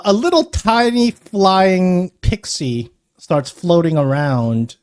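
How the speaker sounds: ordinary delivery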